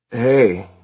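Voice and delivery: laid-back surfer greeting; drawling surfer. A narrator's reading. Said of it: "He had a slow, slightly drawling diction".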